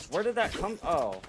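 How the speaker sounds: high startled question. Real, not acted. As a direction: jump at a sudden shot. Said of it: "I was kinda spooked out".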